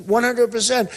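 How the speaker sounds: one hundred percent driven home high; emphatic repeat. Real, not acted. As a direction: repeat it with rising force.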